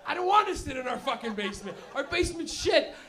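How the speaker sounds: whiny teenage outburst, played loud; acted defiant yell. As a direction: shout back at mom, hammed up. Acted son arguing back at mother.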